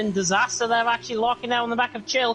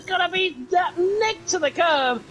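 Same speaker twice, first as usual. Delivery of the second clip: motorsport commentator; 5 semitones higher than usual. Real, not acted. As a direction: call the overtake with building excitement. Close battle called live.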